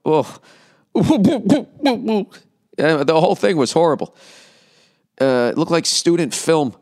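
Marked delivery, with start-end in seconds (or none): 0.9-2.2 high, pulsing put-on sobs; exaggerated high boo-hoo sobbing; mock fake crying. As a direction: imitate shoulder-heaving fake sobs. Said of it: "the fucking shoulders going".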